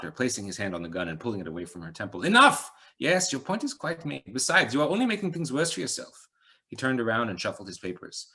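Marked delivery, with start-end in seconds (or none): 2.2-2.7 loud, high commanding Enough; sharp shout. A narrator's reading. Man stops her firing. 3.0-6.3 put-on calm him down after the shout, accent kept; Eastern European accent. A narrator's reading. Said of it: "slight accent, Eastern European".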